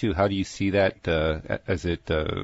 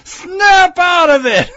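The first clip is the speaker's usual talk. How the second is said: high, drawn-out mock shriek; very loud, slow, hammed-up scream; cartoonish yell; 12 dB louder, much slower than usual. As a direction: yell it like a crazed cartoon character, stretched out. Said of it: "and she yells".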